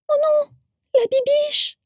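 put-on very high little child's voice crying out; small high child's cry. A narrator's reading. A little guest cries out for the antelope, high and shrill. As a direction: squeal it like a small upset child. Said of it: "cria une petite voix aiguë".

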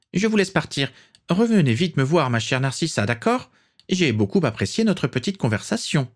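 haughty grande dame, now appeased; gracious old matriarch. A narrator's reading. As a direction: dismiss them kindly. Prisca voice, calmed.

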